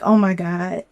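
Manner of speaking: normal talking voice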